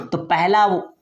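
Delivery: normal talking voice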